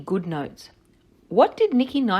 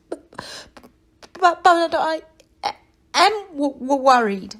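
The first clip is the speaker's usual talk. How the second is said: higher and louder, stumbling over every word; anxious stammer; 8 semitones higher, 8 dB louder, slower than usual. Acted. Nicky insists to Nellie he's worried, loud anxious stammer. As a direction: blurt the worry out in a panicky stutter.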